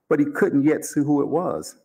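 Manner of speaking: plain reading voice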